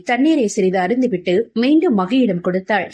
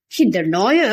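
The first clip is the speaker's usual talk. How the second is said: seething through clenched teeth; through gritted teeth; slower than usual. A narrator's reading. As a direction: grind the words out in anger. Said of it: "பல்லை நர நரவென கடித்தபடி".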